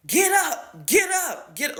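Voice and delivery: high mock scream; imitated screaming. Teacher contrasting herself with the yeller, high mock scream. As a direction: scream it the way she never would. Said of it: "ever screamed at them like".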